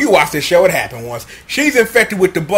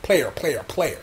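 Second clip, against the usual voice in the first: hushed, lower voice of a smooth mentor; smooth low pimp talk; 8 semitones lower, 7 dB quieter than usual. Retold or imitated. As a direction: lower and much softer, laid-back. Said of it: "he backing on like".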